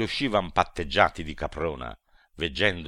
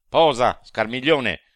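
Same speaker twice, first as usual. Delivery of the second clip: even louder, high repeated order; sharper command; 6 semitones higher, 9 dB louder than usual. A narrator's reading. The demon halts Scarmiglione again, louder high order. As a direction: bark the stop again harder. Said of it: "si volse tutto presto e disse".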